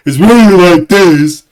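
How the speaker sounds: loud, slow, put-on deep voice; deep-voiced impression of Mayra. Teasing Mayra about how her voice sounds, loud slow deep mockery. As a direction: boom it out slowly in a mock deep voice. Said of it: "it's even an octave deeper than what I just did".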